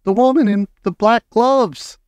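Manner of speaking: high, loud fearful call; frightened shout. A narrator's reading. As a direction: shout down the answer in fear. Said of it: "I shouted".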